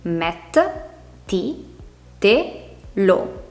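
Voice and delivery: MET, TI, TE, LO with pauses between; separate syllables. Shown as a demonstration. Teacher dividing mettitelo into four syllables, each said apart. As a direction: spell out met, ti, te, lo one at a time. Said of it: "si divide in quattro sillabe".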